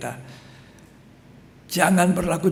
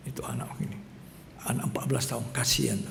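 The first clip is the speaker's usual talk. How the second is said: whispered, then softly murmured; hushed pity; 5 semitones lower, 9 dB quieter, much faster than usual. Retold or imitated. Mother telling pastor about her son, hushed. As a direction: tell the pastor quietly, full of pity.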